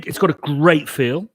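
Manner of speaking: usual speaking voice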